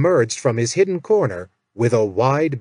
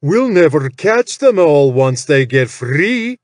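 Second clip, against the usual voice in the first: slow, louder whining gnoll voice; gnoll protesting; 2 semitones higher, 6 dB louder than usual. Acted. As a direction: draw out a nervous creature protest. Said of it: "Karshk protested".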